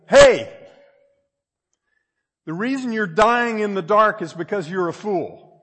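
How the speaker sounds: loud, high jeer; mocking taunt. The same cave voice taunts the caver again, loud and mocking. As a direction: shout the insult down the cave. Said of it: "you heard the same voice say".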